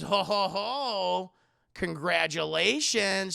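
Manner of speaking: slow, stretched-out jovial chuckle; hammed-up happy doctor; mock jolly congratulations. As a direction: draw it out with fake delight. Acting David's pregnancy news.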